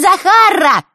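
put-on "Захарра" squeaked high and loud, girly; squeaky voice. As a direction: squeak the name "Захарра" like a silly girl. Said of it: "начал он песклявым голосом".

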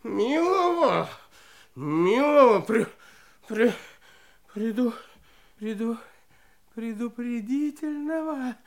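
low, scared, tripping over words; stammering in fear. Acted. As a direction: stumble over the long word. Warden stumbles over the word. (испуганно заикаясь)

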